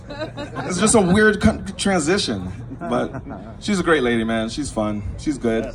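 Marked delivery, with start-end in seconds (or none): none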